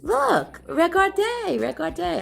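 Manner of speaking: amateur French accent, playfully showing off; bad French accent. Real, not acted. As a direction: mangle regardez in a weak French accent. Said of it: "I know my French accent sucks".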